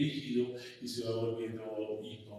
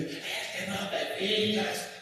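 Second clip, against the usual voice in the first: higher, slow angry rebuke; angry father; 8 semitones higher, slower than usual. Retold or imitated. Father scolding his son who knocked him down, angry and slow. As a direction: scold him, drawn out and high. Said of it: "se levanta muy enojado y dice".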